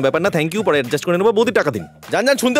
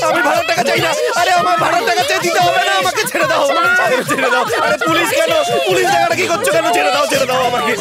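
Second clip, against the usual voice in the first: higher, frantic begging to be let go; panicked pleading; 5 semitones higher than usual. Acted. Framed landlord begs the women to let him go, panicked. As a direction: plead in a rush of fear.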